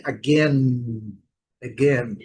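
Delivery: "again" with the final N held clearly; careful model "again", schwa start and full N ending. Shown as a demonstration. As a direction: say "again" landing on a sounded N, tongue up. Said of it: "Observem a letra N".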